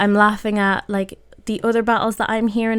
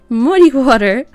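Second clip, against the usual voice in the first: surprised exclaim; 4 semitones higher, 8 dB louder than usual. Real, not acted. Opponent's surprise move.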